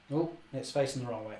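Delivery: ordinary delivery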